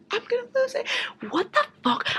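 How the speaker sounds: very high strained squeal. Real, not acted. Reader squealing in frustration over the missing sequel. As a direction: squeal it, losing your mind.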